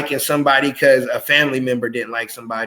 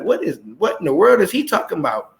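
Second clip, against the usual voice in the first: lightly acted puzzlement, pitched up and quick; baffled listener; 4 semitones higher, faster than usual. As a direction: play a listener who can't make sense of it. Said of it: "and be like".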